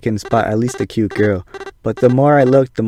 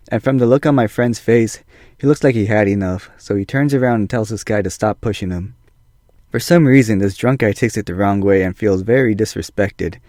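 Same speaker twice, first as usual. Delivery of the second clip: ordinary talk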